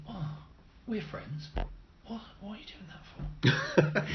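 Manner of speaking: hushed friendly ally voice; soft mock friendly. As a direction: coax gently like a friend. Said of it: "Rather than be like".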